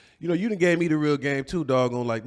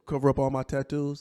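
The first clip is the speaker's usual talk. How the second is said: ordinary delivery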